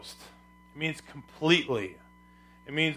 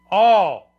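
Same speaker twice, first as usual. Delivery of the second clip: ALL loud and falling; emphatic key word; 3 semitones higher, 8 dB louder than usual. Real, not acted. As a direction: proclaim ALL as the answer. Supplies the key word loudly.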